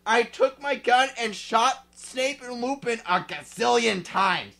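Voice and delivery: ordinary talk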